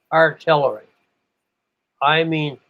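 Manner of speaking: usual speaking voice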